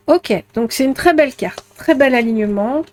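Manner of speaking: ordinary talk, nothing marked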